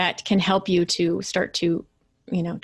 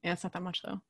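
much softer, downplaying aside; dismissive mutter; 10 dB quieter, faster than usual. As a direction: shrug it off under your breath. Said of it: "I convinced myself, like".

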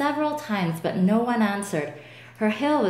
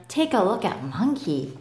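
plain reading voice

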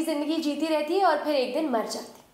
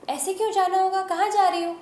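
higher, louder questioning as the companion; puzzled protest; 7 semitones higher, 4 dB louder than usual. Acted. Companion asks the departing heroine why, higher and insistent. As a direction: question it with raised, insistent pitch.

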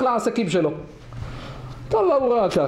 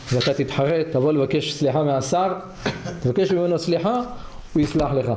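lower, confiding voice of a wise stranger; advising passerby; 7 semitones lower than usual. Acted. Passerby advises the weeping shepherd, low confiding tone. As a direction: lean in low and counsel him kindly.

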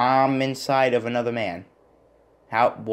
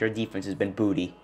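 ordinary talk, nothing marked

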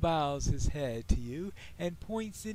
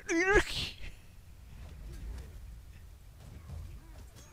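high breathless giggle; giggling laugh; 10 semitones higher, 3 dB quieter than usual. Real, not acted. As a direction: burst into a silent giggle. Laughing at elvish curse.

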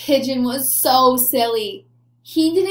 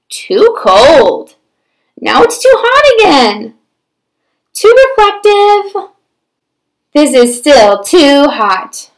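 whiny complaints, one after another; fussy pigeon; 12 dB louder, slower than usual. Acted. Pigeon fussing about the bath water, whiny. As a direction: pick at everything like a fussy bird.

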